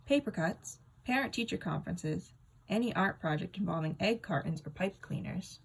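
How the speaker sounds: ordinary delivery, nothing marked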